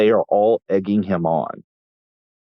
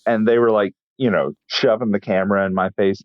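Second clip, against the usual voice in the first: ordinary talk, nothing marked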